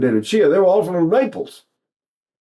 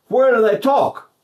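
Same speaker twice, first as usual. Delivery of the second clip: ordinary talk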